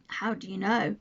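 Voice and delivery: cool, unsympathetic retort; Mary unmoved by his talk of dying. Acted. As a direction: ask it without pity. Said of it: "said Mary unsympathetically".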